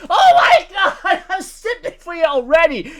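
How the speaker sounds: laughing swoon. Retold or imitated. Rocks' whole crew swooning over Shakuyaku, laughing loudly. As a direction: squeal it out, cracking up.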